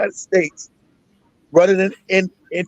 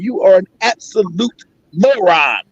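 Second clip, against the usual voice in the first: slow and heavy on "absolute moron"; slow contempt; slower than usual. Real, not acted. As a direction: lay the insult down word by word.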